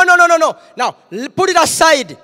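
ordinary talk

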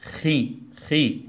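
slow drawn-out syllable khi, repeated; syllable demo. Shown as a demonstration. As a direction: stretch out خي slowly, kh then short i. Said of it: "ينفع نقرأها لوحدها ونقول إيه".

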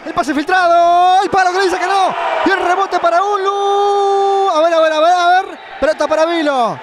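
ramp up the tension; breakneck goal commentary. Acted. Tense build-up call.

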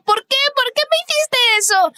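reproche agudo entre llanto; llorando. A narrator's reading. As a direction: pregunta rota, muy aguda, llorando. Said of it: "Ella rompió a llorar y dijo". (Susie llora y reprocha a su hermana)

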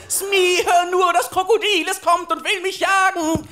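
high, loud cry of alarm; frantic shouting. Acted. Pirate warns Smee of the crocodile, frantic high shout. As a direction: yell for your mate as the beast closes in. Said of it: "schrei aus voller Lunge".

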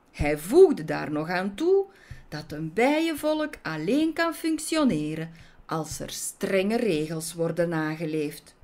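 ordinary delivery, nothing marked

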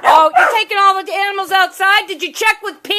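loud, high exclaim at the dog; surprised shout. Real, not acted. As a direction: call out in surprise. Dog carries toys outside.

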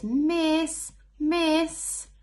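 high, loud calling out; child calling miss. Acted. Teacher reading aloud, voicing a pupil calling miss. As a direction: call for the teacher's attention.

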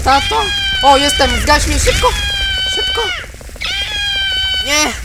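high frantic shriek; panicked scream. Acted. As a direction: scream for help while burning. Burning, begs to be put out.

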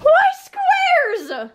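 high, incredulous why; baffled question. Acted. To the cook, baffled by her square meatballs, high incredulous question. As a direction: voice jumps up high in disbelief.

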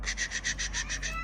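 hissed, voiceless "kış, kış" to chase animals off; shooing hiss. Real, not acted. Father shoos the animals off with a hiss. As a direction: hiss them away.

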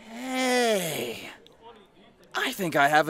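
high, bright hey as a thought strikes; light up with an idea; sudden idea. Acted. Paul, struck by an idea mid-talk, calls out high.

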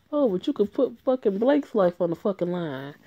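loud, biting sarcasm; scornful jab at the hero; sarcastic outburst. Real, not acted. Streamer snaps back at character.

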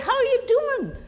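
a hint of a breezy visiting friend; friend's greeting. Friend checks on the injured man, breezy greeting. Friend asks after accident.

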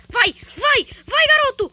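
loud, high urging shouts; loud cheering. Real, not acted. Gamer cheering the character on at the decisive moment. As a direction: cheer him on like a coach.

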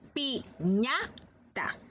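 "Piñata" said very slowly with a lengthened last a. Shown as a demonstration. Teacher sounds out "Piñata" very slowly for children. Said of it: "vamos a pronunciar".

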